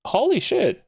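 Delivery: high shocked holy shit; shocked exclamation. Real, not acted. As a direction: gasp it out in real shock. Shocked by unexpected options.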